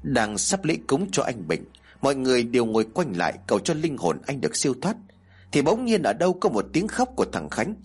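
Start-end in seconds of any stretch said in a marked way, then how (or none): none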